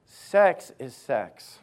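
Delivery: ordinary talk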